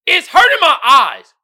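loud, high, hammed-up complaint; shouted meme caption. Vlogger reading a meme caption to viewers, mock shout. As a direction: belt out the caption in mock pain.

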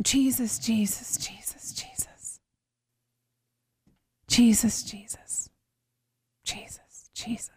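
whispered prayer fading to nothing; desperate whispered prayer. Retold or imitated.

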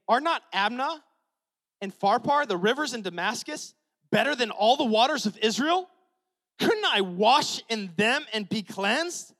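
mock-offended general; indignant protest. Naaman protesting to the prophet, loud and indignant.

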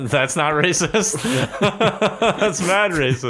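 slow words through laughter; laughing. Real, not acted. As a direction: laugh while saying it. Laughing at the accented voice.

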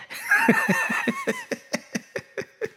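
high wheezy cackle, trailing off; high laugh. Real, not acted. Comic cracks up at own wife bit, high laughing fit. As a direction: crack up helplessly, squealing high.

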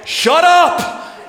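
high, loud snap; sudden shout. Acted. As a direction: bark it at the storm. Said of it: "and he's like".

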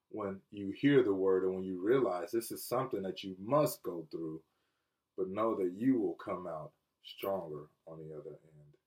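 low, soft, measured sermon voice; gentle solemn preaching. Real, not acted. Pastor assuring listeners they will come out stronger, gentle solemn preaching. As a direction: settle into quiet reassurance.

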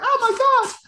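very high-pitched gushing women; mock high female swoon; swooning advert women. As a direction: squeal it like besotted women in an advert. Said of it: "all the women are like".

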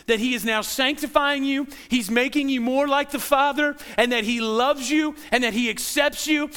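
ordinary talk, nothing marked

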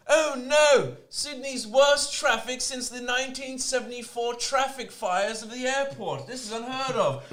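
high, theatrical cry of alarm; hammed-up horror at a bad excuse; mock dismay. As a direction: feign shock as if disaster has struck. Acts out mock horror at traffic.

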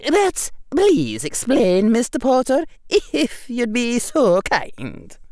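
high, slow, loud Scottish professor; precise Scottish accent. A narrator's reading. Professor asking her student to explain, precise Scottish accent. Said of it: "in her precise Scottish accent".

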